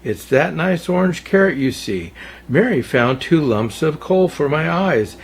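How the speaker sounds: ordinary delivery, nothing marked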